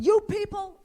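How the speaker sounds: high, loud barked order; mock cop yelling at the extras; mimicking a cop. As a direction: bark it like a cop herding extras. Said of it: "The cop's standing in the middle of the road going".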